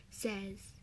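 ordinary delivery

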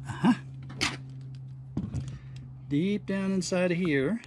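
ordinary delivery

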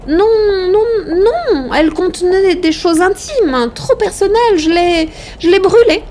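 high-pitched, panicky excuses; high, agitated denial that stumbles and restarts; flustered woman protesting. A narrator's reading. Flustered woman refuses, lying.

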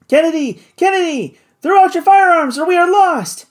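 very high, loud frantic yell; desperate shout. A narrator's reading. Doctor yelling at Kennedy to dump guns, frantic high shout. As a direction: scream the order before they crash. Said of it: "shouted the doctor".

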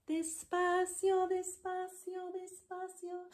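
high, drawn-out, fading despacio; slow. Shown as a demonstration. Teacher showing despacio slowly in a high voice. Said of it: "fast and slow".

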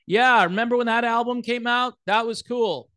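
high, casual rock-fan voice; regular rock listener. Acted. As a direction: play an ordinary music fan reminiscing. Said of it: "what you would normally say".

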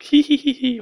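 put-on high giddy kid squeal; little kid giggle. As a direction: squeal like an excited child. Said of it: "I feel like a little kid that's like".

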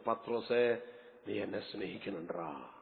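very soft, tender plea to Peter; barely above a breath, asking with love; hushed tender Jesus. Acted. Jesus tenderly asking Peter, very soft and low.

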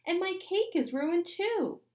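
high, loud complaining shout; angry shout. A narrator's reading. Emily furious about her ruined cake, high angry shout. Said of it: "she shouted".

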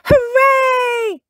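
very high, loud hooray; children's jubilant cheer. A narrator's reading. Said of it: "cheered Peppa and Susie".

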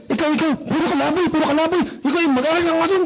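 fast, high-pitched nagging; scolding parent. Acted. Angry parent scolding the child who greeted them, nagging. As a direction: rattle off a peeved telling-off.

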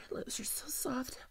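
soft breathy whisper, close; intimate whisper. Acted. Kissing her new coworker, whispering softly. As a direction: breathe it against their lips.

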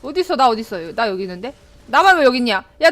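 ordinary talk, nothing marked

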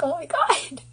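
high-pitched mock dismay; shocked reaction. Girlfriend recalling her shock at boyfriend's lyric, high-pitched dismay.

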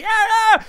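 high yelp of protest; mock protest cry. Mimicking Vinicius protesting to the referee, high yelps. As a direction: mimic a player's whiny protest. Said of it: "protestando y manoteando así".

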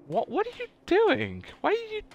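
high startled disbelief; startled surprise. Real, not acted. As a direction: react in shock at the screen. Character misbehaving in game.

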